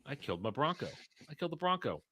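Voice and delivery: small, high, hushed mock lament; quiet high-pitched self-pity, acted out; stunned lament. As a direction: whimper it softly, imitating shock at the loss. Retold first words after wreck.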